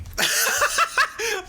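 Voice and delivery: high, loud cackling laugh; sudden high peal of laughter; real laugh. Real, not acted. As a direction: burst out laughing at your own doom. Laughs after "let's die.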